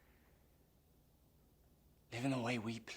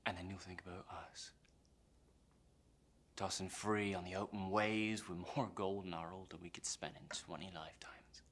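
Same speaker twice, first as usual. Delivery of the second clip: hushed taunting vision; 2 semitones lower, 8 dB quieter than usual. Acted. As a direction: paint the dream for him under your breath. Conjures pirates' freedom for captain.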